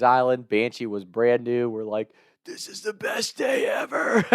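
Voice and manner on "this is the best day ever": high, hoarse mock shout of joy; excited teen cheer. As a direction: cheer like thrilled kids arriving, strained and high. Acting out excited friends.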